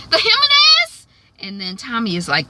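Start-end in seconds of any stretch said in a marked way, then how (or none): 0.1-1.1 very high, loud squawk of disbelief; hammed-up astonished question; incredulous question. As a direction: shoot the pitch way up in mock disbelief. Acting Ghost's disbelief at the news.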